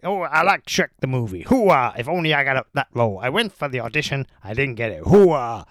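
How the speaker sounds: loud shouty Pacino voice; Al Pacino impression. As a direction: ham it up as Pacino. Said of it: "what else is he like".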